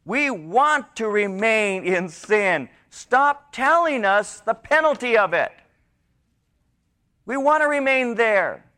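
high, loud, slow preacher's cry; fervent preaching. Acted. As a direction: drive the point home to the congregation. Preaching at full force.

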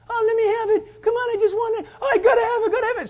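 high, loud, pleading whine; begging child. Retold or imitated. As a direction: beg like a kid who must have it. Imitates child begging for something.

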